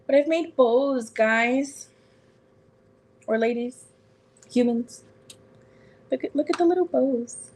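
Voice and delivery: delighted high show-off; excited. Real, not acted. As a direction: gush over the little bows. Showing off cute bows.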